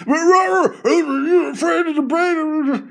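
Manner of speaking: high, slow mocking shout; hammed-up yelling impression. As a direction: bellow it like a ranting host. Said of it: "the yelling".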